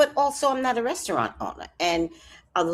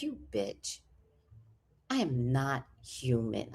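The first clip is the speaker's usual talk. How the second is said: low, much softer cat voice; voicing her cat; 10 semitones lower, 11 dB quieter than usual. Cat refusing human food, low and hushed. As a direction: drop low and quiet for the offended cat. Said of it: "this cat is like".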